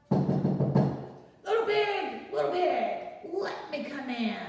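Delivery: wolf calling in. Acted. Wolf calling through the door to the pig, drawn out. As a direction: sing it long and loud at the door.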